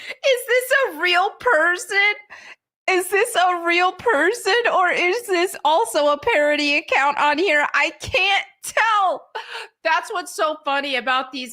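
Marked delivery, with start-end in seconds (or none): 0.1-9.2 high, giggly incredulous questioning; laughing disbelief. Retold or imitated. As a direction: ask it through laughter, baffled. Amused disbelief at a possible parody.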